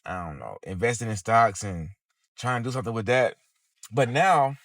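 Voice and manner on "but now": said through a yawn; stifling a yawn mid-phrase. Real, not acted. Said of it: "I don't know why I'm yawning".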